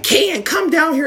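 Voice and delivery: high, drawn-out call; high-pitched retold summons; mock summons. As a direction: imitate a mother calling the kid down, pitched high. Drunk mother summoning in story.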